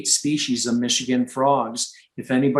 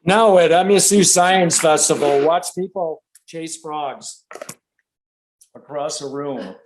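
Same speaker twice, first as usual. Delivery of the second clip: mock announcer; 5 semitones higher, 5 dB louder than usual. Jokey announcement of the chaos.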